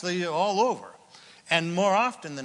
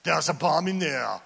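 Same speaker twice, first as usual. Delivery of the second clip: mock Schwarzenegger; 4 dB louder than usual. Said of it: "I was gonna do Arnold Schwarzenegger".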